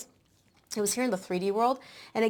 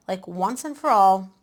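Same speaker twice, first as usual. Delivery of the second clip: once and for all, pressed out slow and loud; emphatic, slow; 8 dB louder than usual. Acted. Telling Leo firmly, slow and loud emphasis. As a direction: lean hard on "once and for all". Stressing finality of goal.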